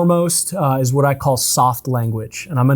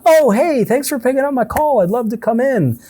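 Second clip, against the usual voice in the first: bright, much higher, eager phone greeting; cheerful caller; 10 semitones higher than usual. Retold or imitated. Caller happy to hear from the office, beaming. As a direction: sound thrilled they picked up. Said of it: "better attitude on the phone".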